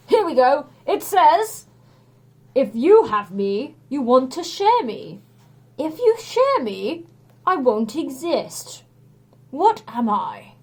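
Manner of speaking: a hint of a grand hero voice; reading the riddle. A narrator's reading. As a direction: intone it like an oracle. Hercules reads the riddle.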